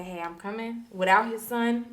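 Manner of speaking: normal talking voice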